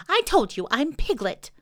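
high, squeaky little voice; insistent Piglet. A narrator's reading.

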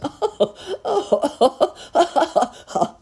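a burst of high, loud laughter switched on at will; sudden demo laugh. Acted. Wise man showing the clown a laugh, loud and high. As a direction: laugh on cue, then cut it dead. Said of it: "suddenly started to laugh and as suddenly turned it off".

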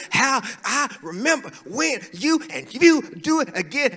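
mock angry parent. Parent scolding child, retold.

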